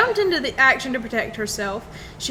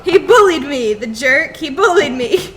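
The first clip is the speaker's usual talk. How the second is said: mock yell; 2 semitones higher, 8 dB louder than usual. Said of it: "yelled out in Chinese".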